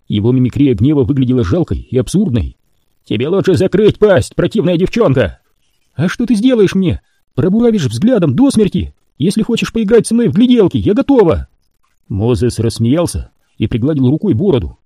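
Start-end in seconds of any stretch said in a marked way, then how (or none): 3.0-5.4 higher, growled threat; threatening mutant. A narrator's reading. Royal threatens. 5.9-11.5 high, mocking challenge; defiant taunt. A narrator's reading. Temple taunts.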